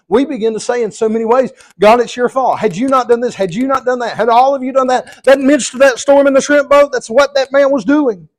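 loud, rising preacher's fervour; full-force preaching. Acted. Preacher driving home his point to the church, loud and fervent. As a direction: preach it hard to the congregation.

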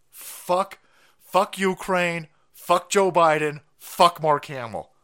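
ordinary talk

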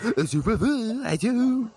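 high-pitched slurred mock French; mocking French drunk gibberish. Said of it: "there's, like, drunk French people".

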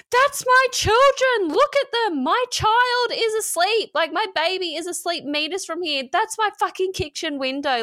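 high, panicked cry of a mother; pleading, almost shrieking, at the others; losing it. Retold or imitated. Mother pointing out her sleeping baby nearby, high panicked cry. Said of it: "I just all of a sudden lost it".

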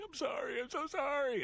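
imitating a bawling girl, very high pitch; high-pitched mock wail of apology. Jack in the Box girl apologizes to him, mock bawling. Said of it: "she's bawling, and she's saying".

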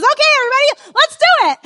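high, loud rallying call; leader voice. Retold or imitated. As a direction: hype up the group like a youth leader. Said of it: "so he says".

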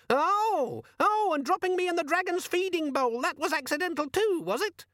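very high, indignant squeal; sarcastic squeaky demon. A narrator's reading. Demon accusing Vimes of dropping it, sarcastic squeal.

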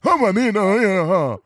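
high, loud garbled mock African accent; hammed-up parody of a fake African accent; bad African accent. Host sending up an actor's attempt, bad African accent. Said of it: "We know what it sounds like".

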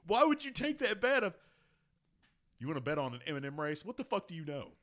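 high-pitched, incredulous scolding; exasperated comeback. Acted. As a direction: act out telling off a gullible friend. Imagined exasperated comeback to Pippen.

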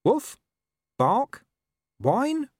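high, separate spoken barks; talking dog. Acted. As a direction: say the dog noises as words, high. Talking dog says its noises as words.

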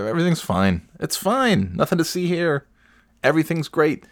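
high mock reassurance; sarcastic. Real, not acted. Mocking officials, high sarcastic calm.

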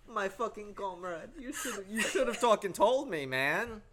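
high, loud laughing rant; outraged but laughing; laughing complaint. Real, not acted. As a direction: scold your friend while cracking up.

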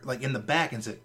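ordinary talk, nothing marked

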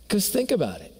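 usual speaking voice

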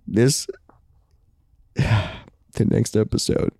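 sighing, slow, breathy fake grief; milk the drama with sighs; hammed-up sorrow. Host pretending he can't say the news, sighing slowly.

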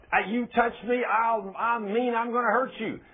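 high, loud warning; acted threat. Woman warning off the minister who touched her, acted threat.

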